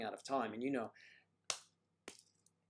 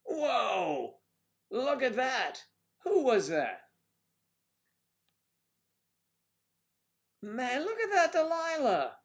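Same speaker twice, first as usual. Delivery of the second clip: loud, high whoop of amazement; big drawn-out high shout; excited hollering; 12 semitones higher, 7 dB louder, slower than usual. Acted. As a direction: holler it high like a thrilled onlooker. Reacting loudly to Delilah's donation.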